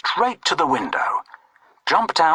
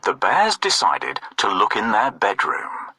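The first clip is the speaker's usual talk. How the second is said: ordinary delivery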